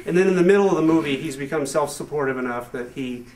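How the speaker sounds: ordinary talk, nothing marked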